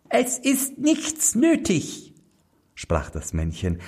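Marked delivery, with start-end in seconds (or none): 0.1-2.1 much higher tiny goblin voice, drawn out slowly; a small servant spirit, unhurried; little manikin, slow. A narrator's reading. (hohe Koboldstimme, langsam)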